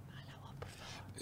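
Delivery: embarrassed whispered plea. Retold or imitated. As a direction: hush her mother in a whisper. Daughter hushing mother on bus.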